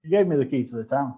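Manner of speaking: usual speaking voice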